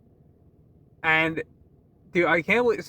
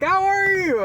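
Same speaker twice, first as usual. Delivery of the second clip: much higher, bright mock coworker greeting; chirpy coworker; 13 semitones higher, 4 dB louder, slower than usual. Imitating coworkers greeting him while he cried daily, much higher. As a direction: squeak it like an overeager coworker.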